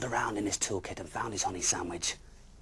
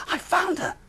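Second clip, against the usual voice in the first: very high loud happy cry; joyful discovery; 12 dB louder than usual. Acted. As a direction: shout out in delight at spotting her. Bear spotting baby.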